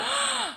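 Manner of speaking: mock gasp.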